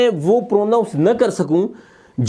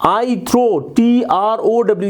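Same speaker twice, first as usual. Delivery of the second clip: ordinary talk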